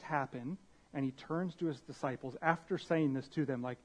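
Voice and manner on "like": ordinary talk, nothing marked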